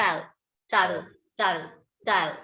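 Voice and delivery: towel said as one short syllable, "tile"; towel drilled slowly, one syllable each time; correct towel drill. Shown as a demonstration. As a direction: repeat towel clipped to a single beat. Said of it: "I'm telling you correct pronunciation".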